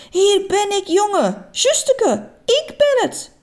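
put-on high, bright old lady calling out; oma Jet calling. A narrator's reading. Grandma calling to her grandson from the sea, high and glad. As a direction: call out like a cheerful granny.

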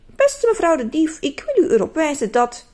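a mock-formal letter voice; too formal. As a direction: rehearse a stiff official complaint. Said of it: "dat is te formeel".

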